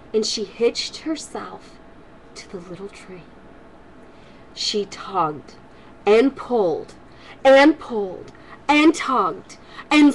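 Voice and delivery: lively storybook read-aloud voice; reading to children. Acted. Grandma reading a storybook to kids, lively and warm. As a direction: read it brightly to the little ones.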